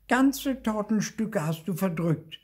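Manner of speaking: high, loud little ghost accusing; piping childish accusation; Zipfel. A narrator's reading. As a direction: accuse your uncle in a high voice. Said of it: "sagt Zipfel".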